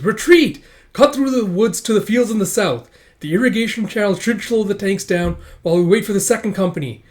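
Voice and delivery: loud, high commanding yell; shouted retreat order. Acted. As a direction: shout orders to fleeing troops.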